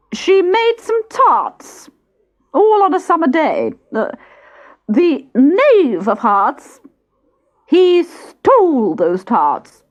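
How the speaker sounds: high, slow, sing-song proclamation; herald reading a rhyme. Acted. Herald reads the charge to the court, high and slow. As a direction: drawl the rhyme out like a pompous herald.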